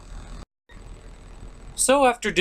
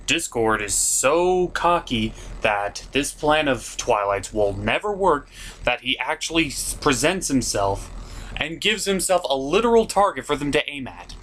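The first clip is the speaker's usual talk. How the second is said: usual speaking voice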